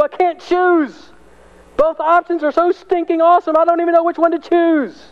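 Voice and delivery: Paul voiced high and full of delight; excited Paul. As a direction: play Paul torn by two wonderful choices. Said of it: "He's like".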